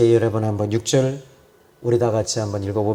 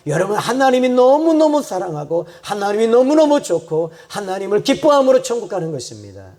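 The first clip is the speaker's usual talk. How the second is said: high, driving sermon delivery; impassioned preaching; 7 semitones higher, 4 dB louder than usual. Real, not acted. Preacher telling the congregation why one goes to heaven, impassioned. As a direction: preach it with rising fervour.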